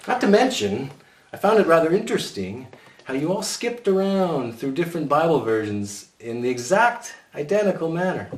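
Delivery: high, arch accusation; sarcastic teacher. A narrator's reading. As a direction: needle them archly. Mrs Jordan accuses the boys.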